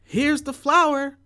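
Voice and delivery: high, loud, slow proud shout. A narrator's reading. Jack to his mother, a high loud shout. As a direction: call it out triumphantly. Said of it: "shouted Jack".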